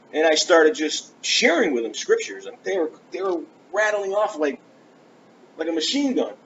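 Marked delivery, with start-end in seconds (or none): none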